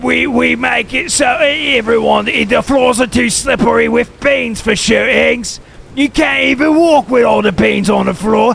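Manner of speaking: loud, high hammed-up London shout; mock British accent. Mocking a British viewer's comeback, loud mock London accent. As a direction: yell it like a cartoon Brit.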